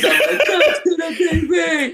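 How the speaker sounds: very high, slow, laughing fake whimper; mock crying. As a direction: whimper it like you're half-laughing, half-sobbing. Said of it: "I'm fucking crying".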